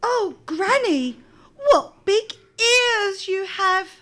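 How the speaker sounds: put-on amazed little girl. A narrator's reading.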